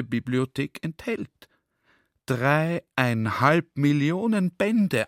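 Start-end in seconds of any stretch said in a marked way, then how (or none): 2.3-5.1 drawn-out, slow and a little louder; slowly weighed answer; slow librarian reply. Retold or imitated. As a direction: spell out the huge number slowly, awed. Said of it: "antwortet er".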